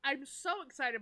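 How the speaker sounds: ordinary talk, nothing marked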